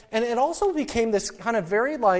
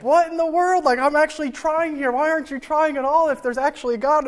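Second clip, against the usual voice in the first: higher, mock-livid outburst; retold exasperation; 7 semitones higher than usual. Venting at the friend who isn't trying, exasperated. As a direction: replay the frustration at a lazy friend. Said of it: "I just get livid".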